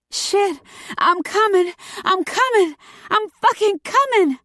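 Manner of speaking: very high, urgent ecstatic cries; high-pitched, over-the-top screams of pleasure; climactic screaming. A narrator's reading. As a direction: squeal it out at the height of climax. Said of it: "started screaming".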